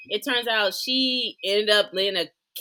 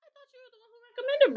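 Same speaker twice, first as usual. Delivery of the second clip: much higher and rushed; surprised accusation. Real, not acted. Friend caught off guard about who recommended the show, high and quick. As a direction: blurt it out in surprise.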